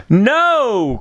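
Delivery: sharp high cry of refusal; startled old lady crying out no; cried out. A narrator's reading. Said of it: "cried the old lady".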